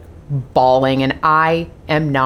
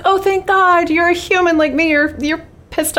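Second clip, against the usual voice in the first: high-pitched mock relief; lightly acted gush of relief, pitched up; Carrie relieved; 13 semitones higher than usual. Imitating a TV girlfriend glad her boyfriend is freaking out too, relieved. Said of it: "And she's like".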